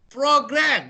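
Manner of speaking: program said high and clear, ending on the m; silent M E. Shown as a demonstration. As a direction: say program with no vowel after the m. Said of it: "the pronunciation is program".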